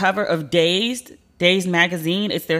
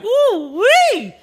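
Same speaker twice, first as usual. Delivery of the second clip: high, loud mock swoon; long, high-pitched playful squeal; swooning whoop; 5 dB louder than usual. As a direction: whoop it out in delighted reaction. Retold thrilled reaction to his voice.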